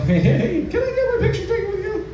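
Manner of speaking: high, fast excited fan voice; fan squeal. Retold or imitated. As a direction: gush like a starstruck fan. Fan asking for photo.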